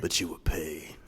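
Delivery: low, quiet menacing threat; a gang boss promising payback, softly; Yakuza boss threat. Retold or imitated. Gang boss warns his captive in a low threat. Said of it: "the Yakuza boss who's like".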